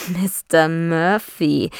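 slow mocking echo of "Mister Murphy". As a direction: drag out "Mister Murphy" in mockery. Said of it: "sagte er spöttisch".

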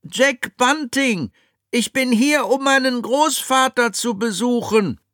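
put-on high young boy voice. Jack's voice in audiobook.

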